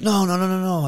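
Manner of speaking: high, rapid-fire backpedalling; retold hasty denial. Opponent excusing himself backstage, defensive.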